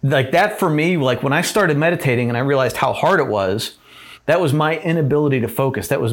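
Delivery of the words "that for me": usual speaking voice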